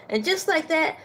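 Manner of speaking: usual speaking voice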